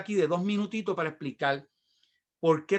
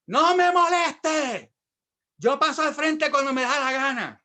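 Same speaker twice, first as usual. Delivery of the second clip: loud, high, drawn-out shout; acting out telling someone off; angry outburst; 8 semitones higher, 5 dB louder than usual. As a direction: explode in indignation, stretching the first words. Retold angry outburst at youth.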